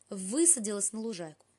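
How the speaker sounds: plain reading voice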